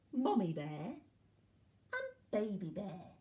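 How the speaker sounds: ordinary delivery, nothing marked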